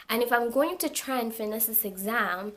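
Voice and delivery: ordinary delivery, nothing marked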